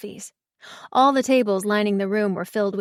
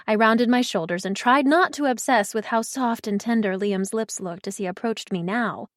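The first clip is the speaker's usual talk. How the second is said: ordinary delivery, nothing marked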